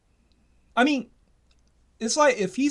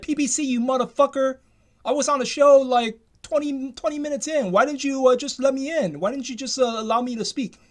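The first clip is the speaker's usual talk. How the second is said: acted angry complaint, higher pitched; angry outburst; 6 semitones higher, 3 dB louder than usual. Caller confronting the show host for making him wait, angry. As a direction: play at angrily demanding why you were shut out. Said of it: "get on the show and get angry".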